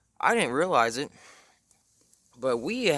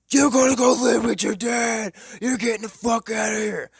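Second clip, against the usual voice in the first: mock screaming; 10 semitones higher, 7 dB louder than usual. Mom threatening to send her son away, high screaming. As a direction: yell it like a mom heard down the road. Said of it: "I can still hear him screaming".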